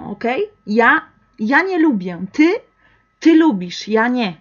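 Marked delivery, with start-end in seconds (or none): 3.2-3.9 ty said louder and higher; stress on ty. Shown as a demonstration. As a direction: point at you with ty. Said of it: "poner más fuerza". (énfasis en ty, más alto y fuerte)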